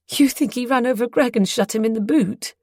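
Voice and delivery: horrified. A narrator's reading. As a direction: blurt it out in horror. Horrified realisation.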